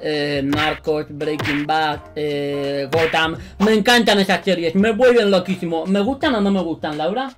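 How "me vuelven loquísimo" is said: ordinary talk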